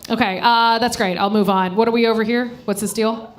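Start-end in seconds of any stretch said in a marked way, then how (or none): none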